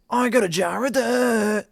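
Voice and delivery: very loud, high pirate crowing; Jack Sparrow accent. Acted. Trying another pirate film line, loud pirate accent. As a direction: crow it out in a hammed-up pirate voice. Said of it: "what else does he say".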